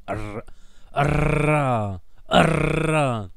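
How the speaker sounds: Ra with a strong rolled r. Shown as a demonstration. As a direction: roll the r in Ra, loud. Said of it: "rouler les airs".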